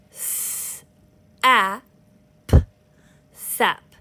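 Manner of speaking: "S, a, p, sap" sound by sound, then blended; one finger per sound. Shown as a demonstration. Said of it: "show you how to break up your words".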